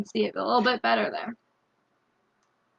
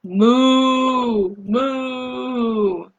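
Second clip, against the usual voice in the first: mock mooing cow; playful cow sound for kids; 3 dB louder than usual. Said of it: "It says".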